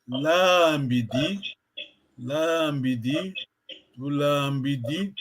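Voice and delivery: LAMBIDI with the LA drawn out slowly; long vowel. Shown as a demonstration. Teacher repeating the long vowel for a student, long vowel. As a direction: stretch the first vowel, "laaambidi", ending on a rise. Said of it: "la voyelle longue".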